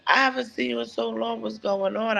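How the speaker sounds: gushing stranger. Retold or imitated. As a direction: imitate an overfriendly stranger. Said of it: "She was like".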